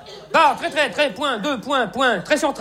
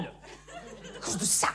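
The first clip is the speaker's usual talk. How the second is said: whispered aside; 7 semitones lower, 15 dB quieter, much slower than usual. Acted.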